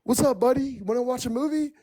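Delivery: mimicking the boyfriend shouting up to her; mock yelled call. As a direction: sing it out like a fake-cheerful buddy. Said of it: "he yells at me".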